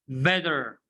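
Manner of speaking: better said bedder; American flap T. Shown as a demonstration. Teacher drills class on better as bedder. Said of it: "Repeat after me".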